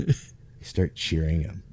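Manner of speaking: ordinary delivery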